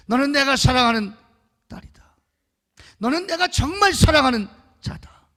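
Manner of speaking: God's loving words called out high and loud; loud, high cry of divine love; jubilant proclamation. Acted. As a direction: proclaim it like a joyful shout from heaven. God's love declared loudly.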